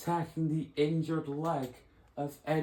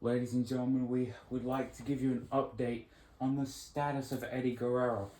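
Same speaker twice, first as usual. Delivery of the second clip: announcer update; 3 semitones lower than usual. Acted. Announcer gives injury update.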